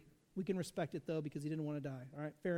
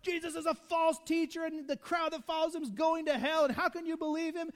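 much higher, louder ranting; frothing outrage; 8 semitones higher, 4 dB louder than usual. Retold or imitated. Preacher acting Pharisees frothing at the mouth, high and loud. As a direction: rant like they're frothing at the mouth. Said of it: "They're frothing at the mouth".

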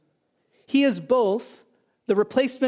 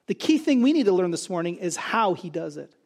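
normal talking voice

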